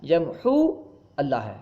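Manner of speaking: "یمحو" with long uu, then a separate "اللہ"; original separated form. Shown as a demonstration. Teacher shows students the original, "یمحو اللہ" in two words. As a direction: break between "یمحو" and "اللہ", sounding the waw. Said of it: "اصل میں".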